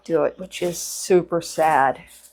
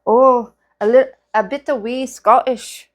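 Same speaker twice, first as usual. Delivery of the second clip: slow, higher, butchered Scottish accent; bad Scottish accent; 5 semitones higher, 3 dB louder, slower than usual. Shown as a demonstration. Vlogger retrying the accent for viewers, badly faked Scottish. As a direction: ham up a halting Scottish accent. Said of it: "I butchered it".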